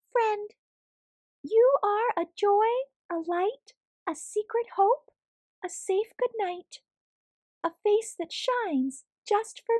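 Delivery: tedere liefdesbrief in een hoog stemmetje; hoge muizenstem. Acted. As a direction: lees het gedicht voor als een klein muisje. Muis leest liefdesbrief voor.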